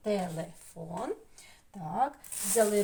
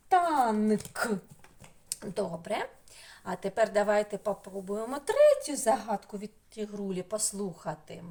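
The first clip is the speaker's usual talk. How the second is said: ordinary delivery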